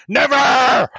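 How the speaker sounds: loud, high Northern Irish "Never!"; Northern Irish shout. Pretend. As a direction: bark "Never!" in a hammed-up Belfast accent. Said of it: "that's the only thing I can do, Northern Ireland".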